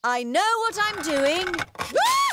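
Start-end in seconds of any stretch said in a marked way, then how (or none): 1.8-2.3 high startled yelp; falling scream. Acted. Mummy toppling into a bush, high scream. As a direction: cry out as you topple over.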